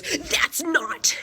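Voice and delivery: imitating a professor's high, loud yell; retold shout, pitched high; mock yell. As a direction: snap it at the student. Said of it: "she puts her hand in my face and yells".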